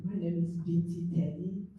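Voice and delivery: ordinary talk, nothing marked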